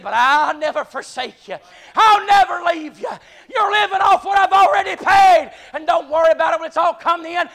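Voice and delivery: sermon peak, voice ringing high and loud; preaching at full force. Acted. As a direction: hoot it out to the rafters.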